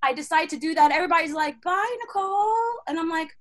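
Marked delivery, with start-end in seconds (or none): 1.6-2.8 high, drawn-out group goodbye; singsong farewell. Retold or imitated. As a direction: call it out slowly in a high singsong. Group calls goodbye in retold story.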